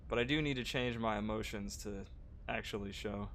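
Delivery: ordinary talk, nothing marked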